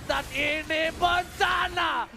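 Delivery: loud, drawn-out victory call; slow shouted victory call. Real, not acted. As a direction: call the winning moment big. Caster calls the game win.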